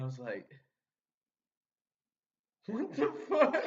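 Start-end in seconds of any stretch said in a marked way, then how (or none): 2.7-3.5 high, loud baffled outburst; stunned what the fuck. Retold or imitated. Son hears he's suspected of bulimia, high baffled outburst. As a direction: blurt it out in total confusion. Confused reaction retold.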